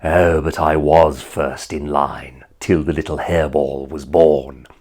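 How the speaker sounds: low, slow sneering villain voice; Jeremy Irons as Scar. Retold or imitated. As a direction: purr it out like a lazy lion villain. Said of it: "He was in The Lion King saying".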